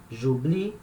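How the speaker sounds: ordinary delivery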